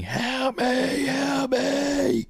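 high, drawn-out strained cry for help; imitated screaming, very high and stretched; mock scream. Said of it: "you hear people just screaming".

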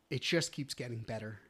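ordinary talk

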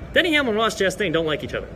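ordinary talk

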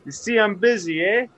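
loud, high New York tough guy; hammed-up New York wiseguy voice; New York accent. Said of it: "Total New York accent".

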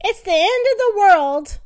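high, loud panic; panicked. Acted. Wife in the falling bed, loud panic. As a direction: cry out in alarm.

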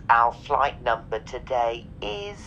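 mock flight attendant PA announcement over music; deadpan cabin-crew read to the passengers; airline announcement.

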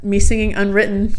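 ordinary talk, nothing marked

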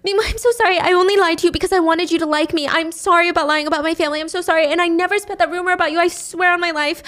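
high, rushed apology; pleading apology. Retold or imitated. Yeti apologizing to tied-up Mimo, high and rushed. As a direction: let the apology tumble out fast.

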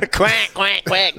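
high mocking quacks; duck voice.

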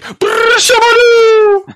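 high, slow drawn-out wrestling cry; John Cena impression. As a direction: stretch it out like a crowd chant. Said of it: "You just do the John Cena thing".

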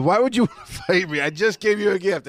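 high, hammed-up fake Italian accent; mock Italian accent. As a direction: plead in a not-quite-Italian accent. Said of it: "It's not Italian at all".

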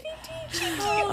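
high-pitched giggle fit; helpless squealing laughter. Real, not acted. Laughter after the tweets.

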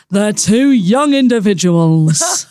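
slow, grand announcer tone; drawn out like an X Factor host; mum's speech voice. Retold or imitated. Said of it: "let's go with the tone that she goes with".

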